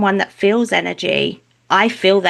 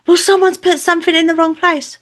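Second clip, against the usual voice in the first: high, louder mock grumble; acted complaint; 10 semitones higher, 5 dB louder than usual. Angry relative explaining to the mother, mock indignant complaint. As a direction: imitate an aggrieved relative, pitched right up.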